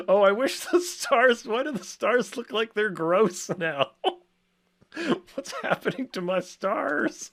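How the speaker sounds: high, hammed-up whiny distress; mock dismay. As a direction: wail over the ruined stars. Stars look wrong, mock distress.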